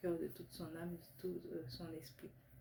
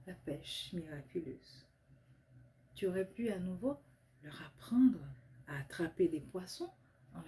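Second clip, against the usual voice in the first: measured phrases, key words swelling, then settling; devotional text reading; 5 dB louder than usual. Real, not acted. Reading own prayer poem aloud to Jesus, devotional recitation. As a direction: recite a heartfelt written prayer with deliberate pauses. C'est un petit texte que j'ai écrit.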